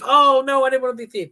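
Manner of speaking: high, loud player's protest; hammed-up cry of protest, easing off in volume; mock dismay. Player learning he's now a thief, mock dismay.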